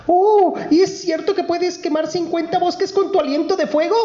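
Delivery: very high, eager little princess. Acted. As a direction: squeal up high like a small girl begging. Princess voice again.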